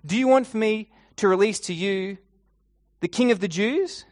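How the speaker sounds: Pilate's loud, high appeal to the crowd; addressing a crowd. Acted. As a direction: call it out to a whole crowd. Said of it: "he asks the crowd his question".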